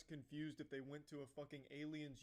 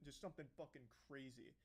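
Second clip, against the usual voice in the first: normal talking voice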